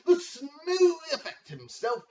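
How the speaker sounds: high, slow, loud drawn-out announcer yell; ring announcer call. Acted. Commissioner hyping himself to the league. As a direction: stretch the name like a ring announcer. Announcing players, hype intro.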